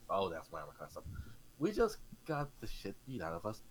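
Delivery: ordinary talk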